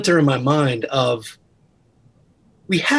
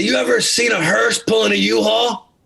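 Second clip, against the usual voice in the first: deep gravelly mentor voice; 7 semitones higher than usual. Retold or imitated. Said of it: "he had this deep, gravelly voice".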